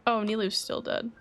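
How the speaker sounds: ordinary delivery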